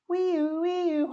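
high siren wee-oo; siren wail. Acted. Little truck on the way, siren call.

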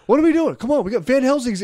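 high-pitched nagging imitation of a boss; mock exasperated boss. Cousin finds the crew leaning on shovels, mock exasperated. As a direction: hurry the slackers along in mock exasperation.

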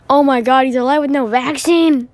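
loud astonished exclaim; loud shocked gasp. Acted. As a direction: blurt it out in disbelief. Shock at survivor.

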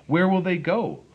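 high, loud, worried question; alarmed question. Acted. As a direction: blurt it out in alarm. Onceler asks about Barbaloots.

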